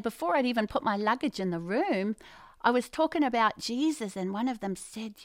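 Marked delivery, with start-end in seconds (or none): none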